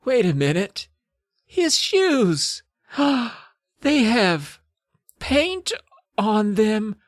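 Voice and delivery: high, halting mock shock with a gasp; dramatic realization. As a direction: discover it piece by piece, hammed up. Amy discovers painted shoes.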